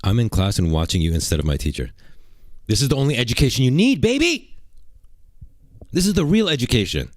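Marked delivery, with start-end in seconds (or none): 2.7-7.1 hyped shout-out. Real, not acted. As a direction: sell it like a hype man. Hyping his stream to viewers.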